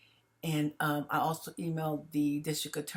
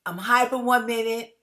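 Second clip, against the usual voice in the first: worked-up, higher and louder; hyper; 4 semitones higher, 6 dB louder than usual. Real, not acted. Explaining own stressed state to viewers, hyper. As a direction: get wound up, pitch lifting. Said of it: "I know I sound crazy".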